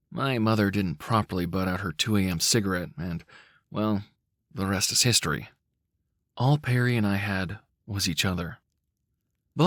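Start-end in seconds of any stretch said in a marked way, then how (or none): none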